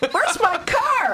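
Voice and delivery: panicked car owner. Pretend. Acted car owner in derby bit.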